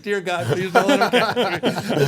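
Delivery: high, loud, slow pleading; hammed-up panicked prayer through laughter; mock desperate prayer. Young skater chased by two huge guys, mock desperate prayer. Kid praying while being chased.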